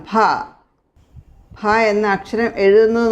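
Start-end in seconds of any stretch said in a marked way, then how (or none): 0.1-0.1 ഭ with an aspirated b, "bha"; careful pronunciation. Shown as a demonstration. Teacher showing students how to pronounce it properly, careful aspirated ഭ. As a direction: say ഭ as a breath-released "bha". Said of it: "ഇത് ശരിക്ക് pronounce ചെയ്ത്".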